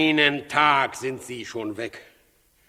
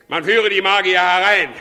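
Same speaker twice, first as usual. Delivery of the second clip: higher, much louder royal order; loud command; 6 semitones higher, 8 dB louder than usual. Acted. Herodes ordering the servant to bring the magi, loud command. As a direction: bark the order across the hall.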